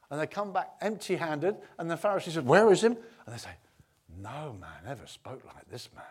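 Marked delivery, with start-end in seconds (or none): 2.5-2.9 loud, higher confronting question; stern demand. Acted. Pharisees confront empty-handed soldiers. 4.2-6.0 low, soft reply in wonder; hushed awe. Acted. Awed soldiers answer the Pharisees.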